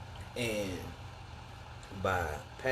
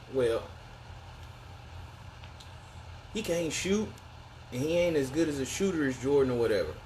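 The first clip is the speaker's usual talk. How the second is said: higher, fast mock critic; mocking hater voice; 7 semitones higher than usual. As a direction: parody the doubters' whine.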